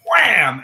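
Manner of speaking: loud, sudden "wham"; loud impact noise; imitated whack. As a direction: punch out the sound of a hard hit. Imitates the blow of a cue.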